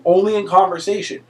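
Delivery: usual speaking voice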